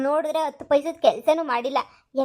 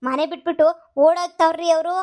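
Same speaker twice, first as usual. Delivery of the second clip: agitated; 3 semitones higher, 4 dB louder than usual. Acted. Wife agitated about fleeing maids.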